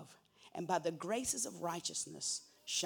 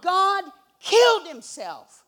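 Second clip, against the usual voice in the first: slow, much louder and higher, full-force preaching; preaching shout; 11 semitones higher, 17 dB louder, slower than usual. Acted. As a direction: belt it out to the congregation, slowly. Preacher repeats, shouting to crowd.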